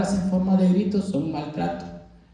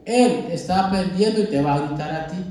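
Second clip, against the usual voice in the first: ordinary talk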